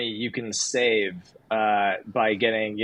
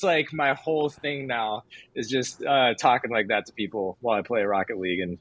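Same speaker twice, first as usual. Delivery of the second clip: normal talking voice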